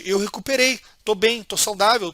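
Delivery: ordinary talk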